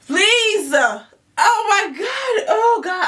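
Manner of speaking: high, crying with laughter; can't stop laughing, gasping out words; howling with laughter; laughing fit. Real, not acted. Cracking up laughing.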